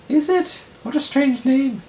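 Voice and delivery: very high, loud falsetto character voice; piercingly high cartoonish put-on voice; Anthony Falcon. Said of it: "Anthony Falcon".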